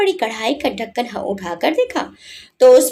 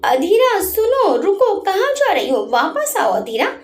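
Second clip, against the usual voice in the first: calling after her; 6 semitones higher than usual. A narrator's reading. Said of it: "वीर ने उसे पीछे से आवाजें दी".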